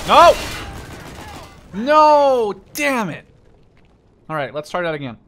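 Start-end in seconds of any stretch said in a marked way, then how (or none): 0.1-3.2 loud, high cry of defeat; anguished shout. Real, not acted. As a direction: shout in despair. Loses the round.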